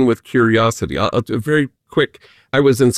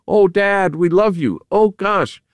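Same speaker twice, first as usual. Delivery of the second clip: higher, gently pleading family voices; mock worried siblings; 7 semitones higher than usual. Siblings fussing over their father, lightly pleading. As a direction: coax a sick dad with mock concern.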